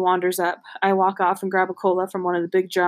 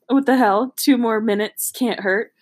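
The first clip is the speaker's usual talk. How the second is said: ordinary delivery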